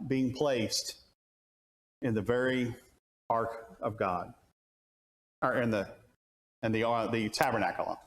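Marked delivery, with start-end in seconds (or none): none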